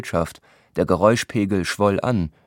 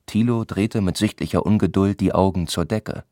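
plain reading voice

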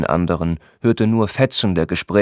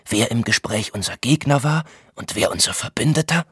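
higher, hushed and urgent questioning; confiding Thilo; 5 semitones higher than usual. A narrator's reading. Thilo leans close to Voynich with his suspicion, confidingly. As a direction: lean in and confide the worry in a higher voice.

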